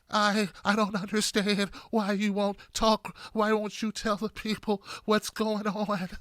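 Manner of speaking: slow, soft, pleading mock of Elizabeth Warren. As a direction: whimper it out slowly like a scolded senator. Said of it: "She just talks like this".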